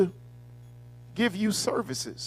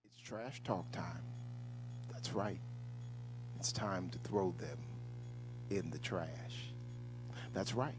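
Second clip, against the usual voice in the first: hushed, low and much softer, half whispered; mock calm; 7 semitones lower, 10 dB quieter than usual. Host to viewers, pretending to stay calm: hushed mock serenity. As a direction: play it exaggeratedly serene, barely above a whisper. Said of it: "today I am my calm, cool, and collected self".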